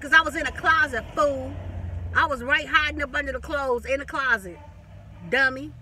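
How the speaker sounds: loud, triumphant telling-off; loud gotcha. Retold or imitated. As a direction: shout it at him like you caught him. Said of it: "I was like".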